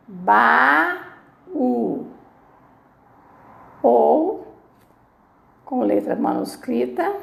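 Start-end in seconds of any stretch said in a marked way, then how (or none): 0.1-2.1 "Baú" in two beats, long ba, pause, ú; "Baú" broken slowly into ba and ú; split into syllables. Shown as a demonstration. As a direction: sound out "Baú" with a gap before the ú. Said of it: "eu separo a sílaba".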